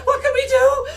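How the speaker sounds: high, rising to a near-sobbing cry; panicked plea. Acted. Mother begging her son for help, panicked. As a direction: beg in panic.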